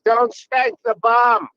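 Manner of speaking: put-on slow, loud, high child voice; stripper's kid. Comic quoting the kid in the other room, high childlike scolding. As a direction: imitate a little kid scolding, drawn out. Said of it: "and she goes".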